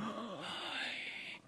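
high breathy gasp; mock gasp. Gasp in the joke.

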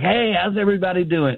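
bright high greeting calling out; jovial dad walking in; cheery hello. Retold or imitated. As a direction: sing out a happy hello at the door. Cheerful greeting coming home.

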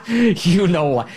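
you know why, said through a laugh; laughing knowingly. Real, not acted. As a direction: chuckle through it, slow. Repeat, laughing.

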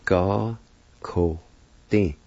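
slow teaching demo, pause after each syllable. Shown as a demonstration. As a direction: separate each syllable clearly. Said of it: "is pronounced like this".

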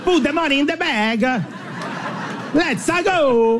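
high-pitched cartoonish Italian robber; a robbery order in a high, silly Italian accent; Super Mario. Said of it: "if you sound like Super Mario".

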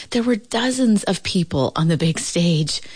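usual speaking voice

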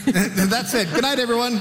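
high laughing mock farewell; laughing mock sign-off. Panelist jokingly ends the show, laughing. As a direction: joke goodbye through laughter.

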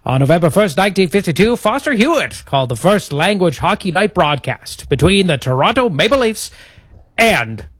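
high, theatrical vintage radio announcer; old-timey radio voice. Shown as a demonstration. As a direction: announce it like a 1950s broadcaster. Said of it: "do the next bit in the old-timey radio voice".

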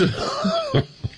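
a sudden high laugh breaking through; real laugh he can't hold back; high burst of laughter. Real, not acted. Host cracks up.

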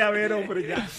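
normal talking voice

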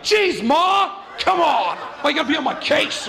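put-on exasperated kid yelling at mom, high and loud; high, indignant mock shout; retold yelling. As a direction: whine it loudly like a fed-up twelve-year-old. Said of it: "I yelled at her".

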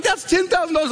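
high-pitched imitation of an amazed wife; astonished wife. Wife reacting to her husband's check, high astonished voice.

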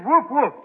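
mock dog woof in the Greek accent; dog's woof. [name] voices his dog ordering a beer with a mock woof. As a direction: bark it like the dog. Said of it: "he said".

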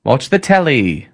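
slow, put-on Australian accent; a shaky try at an Aussie accent. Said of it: "Mark's Australian accent".

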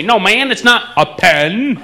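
slow Texan pen said as pin; mock Texan correcting; Texan drawl, pen as pin; imitating Texan. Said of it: "He tries to imitate me".

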